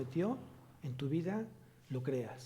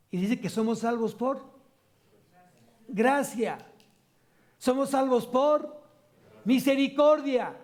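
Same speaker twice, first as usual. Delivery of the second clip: preaching at full force; 6 semitones higher, 6 dB louder than usual. Acted. Preaching climax.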